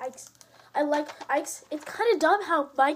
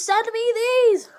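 high, drawn-out shouted demand; shouted plea; 8 semitones higher, 8 dB louder, much slower than usual. Real, not acted. Repeats the plea, more forcefully.

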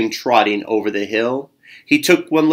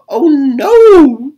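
high, loud cry of panic; panicked shout; 14 semitones higher, 10 dB louder than usual. Acted. Bear Louise shouts in panic as the clock chimes eight. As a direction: shout it out in sudden alarm. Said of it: "Bear Louise shouted".

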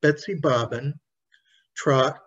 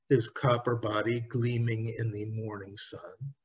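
usual speaking voice

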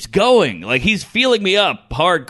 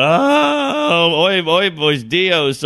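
mock Spanish lament; much slower than usual. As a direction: imitate a fretting Spanish speaker.

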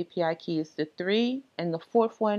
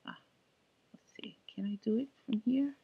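soft unvoiced whisper to himself; whispered aside; 5 semitones higher, 7 dB quieter, faster than usual. Real, not acted. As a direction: mutter it under your breath while searching. Muttering to himself at computer.

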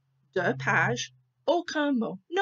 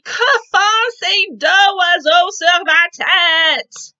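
loud, higher, slowed shout of outrage; angry shout; 9 semitones higher, 13 dB louder, slower than usual. Acted. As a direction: bellow it out, fed up and alarmed. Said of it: "Oh, il crie".

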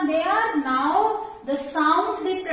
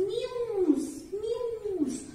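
higher, softer little mew, mew; light higher-pitched cat sound; cat mew imitation; 5 semitones higher, 5 dB quieter than usual. Shown as a demonstration. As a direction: mimic a kitten's mew for the class. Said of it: "which sound cat produce?".

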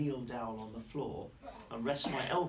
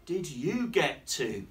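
loud, slow, swooping question; big drawn-out parental question, pitched up; questioning daddy; 5 semitones higher, 8 dB louder, much slower than usual. Acted. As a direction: stretch it out like a storybook dad. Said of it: "says Daddy".

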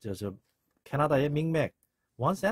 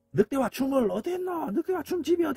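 very high-pitched calling out; hammed-up searching cry; frantic searcher; 13 semitones higher, 3 dB louder than usual. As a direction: call out as if hunting for someone's house. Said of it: "하면서 막 돌아다니는 겁니다".

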